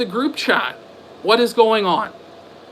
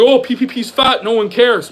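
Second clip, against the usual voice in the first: a little louder, put-on dismissive voice imitating a critic; mocking quote; 3 semitones higher, 5 dB louder than usual. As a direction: sneer it like a smug troll. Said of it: "It's like".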